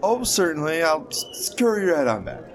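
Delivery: mock servile. Player mock-obsequious reply.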